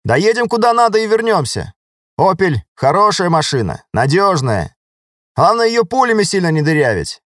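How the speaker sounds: high-pitched, upbeat soldier voice; confident soldier driver. Acted. Driver vouches for the Opel to his lieutenant, confidently. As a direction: vouch for it eagerly, pitch lifted.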